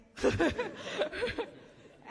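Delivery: high, loud rising laugh; real laugh. Real, not acted. Finishing her story to the congregation, laughing. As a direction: laugh warmly at yourself.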